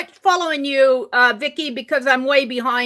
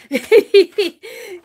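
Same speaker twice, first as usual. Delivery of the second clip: short laugh, higher and a bit louder; happy laugh; 7 semitones higher, 4 dB louder than usual. Real, not acted. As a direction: laugh out loud at a kind comment. Laughing at viewer's comment.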